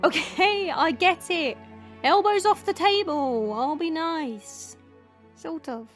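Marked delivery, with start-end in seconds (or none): 0.0-4.8 high sing-song, drawn out at the end; mocking compliance. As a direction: parrot the rules like a nagged kid. Mocking obedience after a scolding.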